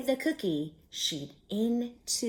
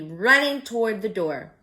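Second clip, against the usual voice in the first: plain reading voice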